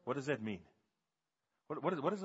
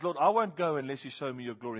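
louder, stubborn refusal; insistent Moses; 2 semitones higher, 8 dB louder than usual. Retold or imitated. Moses refusing to go without God's glory, loud and insistent. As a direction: dig in and insist. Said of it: "he says".

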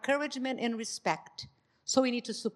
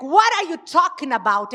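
louder, higher mock indignation; imitating angry husband; 6 semitones higher, 7 dB louder than usual. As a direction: play the husband getting defensive. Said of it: "He was gonna just come mad at me".

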